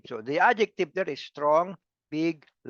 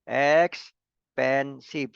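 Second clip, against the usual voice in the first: EXPENSIVE drawn out slowly as EX, PEN, SIVE; syllable by syllable; much slower than usual. Shown as a demonstration. Teacher repeating three syllables for the class, slowly one by one. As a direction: give each of the three syllables in EXPENSIVE its own beat. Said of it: "three syllables".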